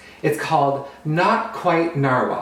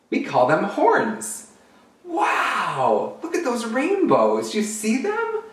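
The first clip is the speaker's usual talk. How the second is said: high, gushing unicorn chatter; 7 semitones higher, slower than usual. Acted. Unicorns show a new friend rainbows, high and excited.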